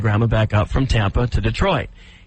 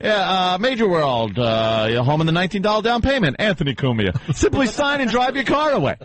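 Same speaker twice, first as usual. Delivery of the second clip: sing-song car ad pitch; lightly hammed-up radio spot with name thrown in; mock commercial read; 4 semitones higher than usual. Host parodying a car dealer ad, mock announcer read. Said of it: "It's like, it's like".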